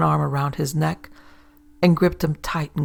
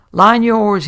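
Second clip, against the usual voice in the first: loud, high barked order to the rower; a sharp shouted command from the stern; called order; 5 semitones higher, 10 dB louder than usual. Acted. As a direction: call it out across the water. Auntie orders rowing to stop.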